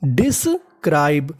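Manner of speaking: Describe said slowly, syllable by syllable; slow careful word. Shown as a demonstration. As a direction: stretch out describe clearly for the class. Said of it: "तो meaning बनी". (धीरे-धीरे साफ़ उच्चारण)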